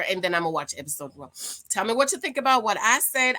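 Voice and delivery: ordinary delivery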